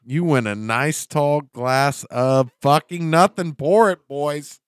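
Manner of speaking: loud, slow announcer voice; game show host. Pretend.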